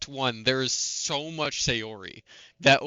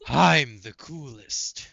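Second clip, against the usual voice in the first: slow smug hedgehog voice; 4 dB louder, slower than usual. Acted. Shadow bit continues.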